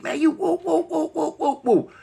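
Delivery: high, panicked whoa whoa; going crazy in the back seat, yelling high; frantic protest. Retold or imitated. In the back seat, protesting to the police, frantic. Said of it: "I'm going crazy in the back seat".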